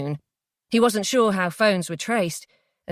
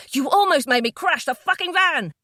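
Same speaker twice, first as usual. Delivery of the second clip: high angry shout; 8 semitones higher, 3 dB louder than usual. Acted. Jay shouting at Felicity after nearly crashing the van. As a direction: shout it at her in fury. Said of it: "he shouted at her".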